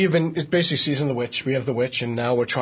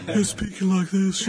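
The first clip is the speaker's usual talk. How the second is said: a woman's voice pitched down; 5 semitones higher than usual. Shown as a demonstration. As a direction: voice pitched down, slightly laughing. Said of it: "I don't like to pitch women down".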